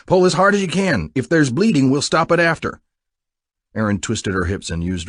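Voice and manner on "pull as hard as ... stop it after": higher, louder coaching; urgent encouragement. A narrator's reading. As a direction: drive her on through the strain. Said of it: "Buck encouraged".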